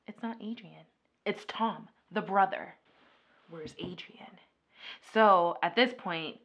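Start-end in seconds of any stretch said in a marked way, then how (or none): 3.5-4.4 whispered question. Retold or imitated. Suspense in retelling.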